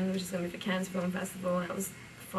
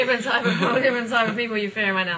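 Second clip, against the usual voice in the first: laughing; 3 semitones higher, 8 dB louder than usual. Real, not acted. Laughing at his own joke.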